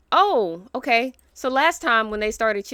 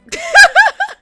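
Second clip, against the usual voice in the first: burst of high laughter; 17 dB louder than usual. Real, not acted. Laughing at her own outburst.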